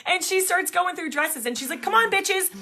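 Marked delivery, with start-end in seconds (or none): 1.8-2.5 high, cheeky imitation of rallying; mock-bossy call, pitched way up; playful rallying. Stranger rallying the two friends through dresses, playfully bossy.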